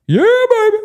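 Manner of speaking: very high, loud whoop; all-out church yell. Shown as a demonstration. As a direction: let it rip at the pastor, high and loud. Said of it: "If it really hits me".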